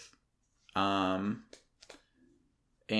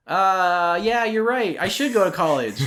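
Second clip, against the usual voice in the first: much higher and louder, sarcastic yes; mock agreement; 9 semitones higher, 7 dB louder than usual. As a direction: agree with a hammed-up sheepish yes. Retelling his reply to exes.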